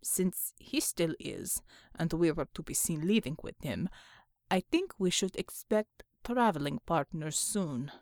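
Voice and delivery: low Russian-sounding man's voice; deep-pitched foreign soldier assessing danger; stoic comrade driver, matter-of-fact. Acted. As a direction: report it low in broken English. Untermensch figure in the story.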